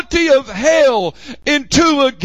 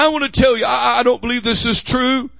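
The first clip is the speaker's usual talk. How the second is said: ordinary delivery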